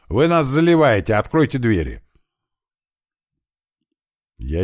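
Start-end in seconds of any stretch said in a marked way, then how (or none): 0.1-2.0 loud, high hollered complaint; sudden yell. A narrator's reading. Posing as flooded neighbours demanding entry, sudden yell. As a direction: holler it through the door. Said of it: "неожиданно заорал Кирпич".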